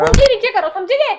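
loud, high, forceful order; angry command. Real, not acted. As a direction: lay down the law at full volume. Wife orders finger down.

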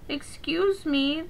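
a small, polite attempt at bravery; timidly brave. A narrator's reading. Duckling approaching geese at the river, softly brave. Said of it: "duckling began bravely".

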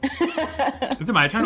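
laughing. Real, not acted. Laughing, surprised at her turn.

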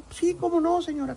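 a light imitation of an eager celebrity; mock-courteous reply; obliging. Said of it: "Y el gordo".

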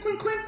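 imitated clink of gold, pitched high; ping the clinks up high; high clinking sound.

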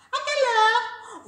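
high, loud little pig question; squeaky piglet asking. Acted. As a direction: pipe up brightly and high. Pig asks the wolf.

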